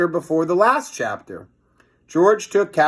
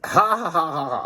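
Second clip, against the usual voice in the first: real laugh; 3 semitones higher than usual. Real, not acted. Reader laughs at the silly new name, laughing.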